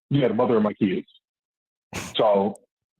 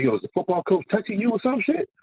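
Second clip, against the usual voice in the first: much higher, alarmed retold question; retold scared question; 10 semitones higher than usual. Father asking his son about the coach, scared. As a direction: replay a father's sudden worry. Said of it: "I got scared".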